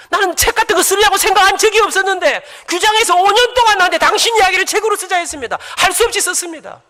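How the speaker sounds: high, loud testimony from the pulpit; loud preaching. Acted. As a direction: belt it out to the congregation. Preaching at full force. (높고 큰 소리로 외치는 설교)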